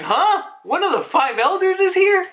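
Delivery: high, loud mock astonishment; shocked disbelief. Marines learn an Elder is coming, shocked disbelief. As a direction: squawk the question in disbelief.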